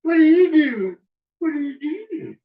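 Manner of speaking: high sing-song voice for the dog; high baby-talk question to a pet. Acted.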